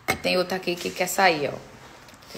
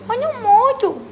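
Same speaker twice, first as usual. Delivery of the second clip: "Quando morto" much higher, punchline; joking, much higher "Quando morto" repeated for the punchline; higher emphatic repeat; 13 semitones higher than usual. Shown as a demonstration. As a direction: hit "Quando morto" high as a joke. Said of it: "repeat with emphasis".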